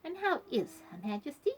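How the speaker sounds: a touch of prim, polite inquiry. A narrator's reading. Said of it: "she went on politely".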